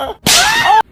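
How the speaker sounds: a sudden very high, loud shocked cry; shocked shout. Acted. Mother shrieking in shock at her son. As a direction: yelp in pure alarm.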